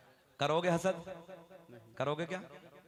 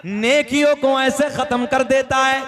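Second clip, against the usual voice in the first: slow, loud, higher sermon chant; preaching at full force; 5 semitones higher, 12 dB louder, slower than usual. Acted. Chanted hadith line.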